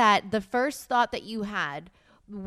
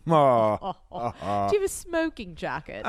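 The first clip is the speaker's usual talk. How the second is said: mock British; 5 semitones lower, 3 dB louder, much slower than usual. Continuing the British parody.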